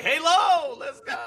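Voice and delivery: teasing a friend who doesn't know Halo; mock hype. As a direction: shout it like a clueless hype man. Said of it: "You're just like".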